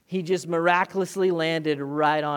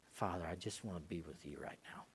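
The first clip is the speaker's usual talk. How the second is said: much softer, lower intimate prayer; 9 semitones lower, 18 dB quieter, faster than usual. Acted. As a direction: pray it gently and close. Heart praying to God.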